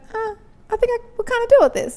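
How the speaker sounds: lightly acted, high musing voice; mock giving in. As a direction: imitate someone talking themselves into it. Voicing someone giving in once sex enters.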